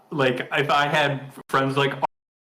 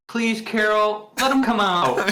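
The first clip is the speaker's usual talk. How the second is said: Bruce Dern's pleading read; 7 semitones higher than usual. Retold or imitated. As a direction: plead with Carol, stretching each word. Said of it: "Bruce Dern's reading of the".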